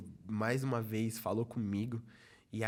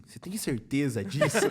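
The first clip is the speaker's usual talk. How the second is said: laughing quote; 7 semitones higher, 8 dB louder than usual. Real, not acted. Father's question, then laughter.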